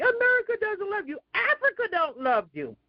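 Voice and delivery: high, fast mocking of him; mocking imitation. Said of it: "he wanna say".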